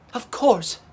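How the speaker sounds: high excited "Of course"; cry of realisation. A narrator's reading. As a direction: burst out with sudden understanding.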